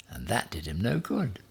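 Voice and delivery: usual speaking voice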